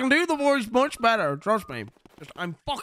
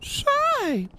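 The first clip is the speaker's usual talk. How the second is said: put-on much higher, sharp old man's "Say!"; Herbert's call. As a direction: call out like a creepy old neighbor. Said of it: "Oh, it's come back".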